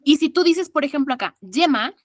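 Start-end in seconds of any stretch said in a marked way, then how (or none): 1.4-1.9 yema with the y as a firm consonant, like ll; yema, opening on a Spanish double-L sound. Shown as a demonstration. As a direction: say yema starting on a solid ll sound. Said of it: "suena como doble L".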